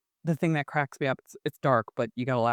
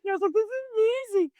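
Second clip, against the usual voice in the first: tearful high cry. Retold or imitated. Telling a guest about mom's wisdom, voice breaking with tears. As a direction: squeak it out through happy tears. Moved by mom's words, crying.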